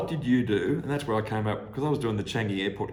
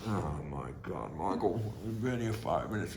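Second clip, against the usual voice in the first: embarrassed boss aside; 4 semitones lower, 6 dB quieter than usual. Retold or imitated.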